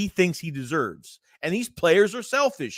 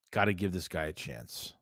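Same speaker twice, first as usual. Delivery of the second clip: quiet repeat; 12 semitones lower, 11 dB quieter than usual. Real, not acted. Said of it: "repeats the point quietly".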